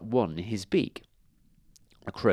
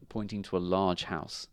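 usual speaking voice

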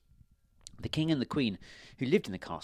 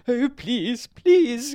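very high, loud wail; crying plea; 13 semitones higher, 11 dB louder, much slower than usual. A narrator's reading. Queen begs the baron, high wailing plea. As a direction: beg loudly in a high queen voice. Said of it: "cried the queen".

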